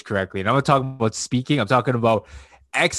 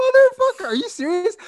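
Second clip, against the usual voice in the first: incredulous outburst; 7 dB louder than usual. Retold or imitated. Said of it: "It's just like".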